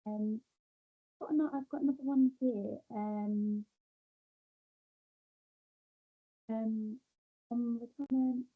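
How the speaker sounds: muffled, like a hand over the mic. Real, not acted. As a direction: sound muffled and far off. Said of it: "you're really muffled".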